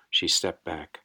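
plain reading voice

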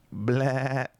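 high bleating blah like a sheep; sheep-like gun noise. Shown as a demonstration. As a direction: let it come out like a sheep. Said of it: "Is that a sheep?".

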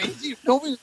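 ordinary delivery

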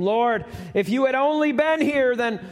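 high, pleading lament of a sister; grieving sister. Acted. Martha pleading with Jesus over her dead brother, high lament. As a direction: plead with him, it's too late.

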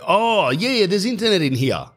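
high, bright-eyed fake enthusiasm; mock eager reply. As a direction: pitch it way up like an overeager landlord. Narrator's eager reply to tenants.

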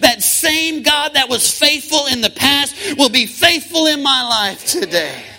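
loud, rising preacher cadence over music; full-force preaching. Acted. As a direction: preach it out with fire.

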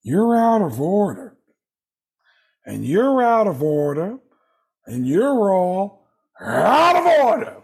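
slow, high, drawn-out movie-star impression; Al Pacino. Movie star ranting among broken washers, hammed-up impression. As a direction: stretch each phrase into a hammed-up courtroom outburst, building. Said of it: "they cut to me as Al Pacino".

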